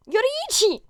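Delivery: high, loud excited shout of a name; excited cry. Acted. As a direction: burst out the name in delight. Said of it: "Izuku vibrates with excitement".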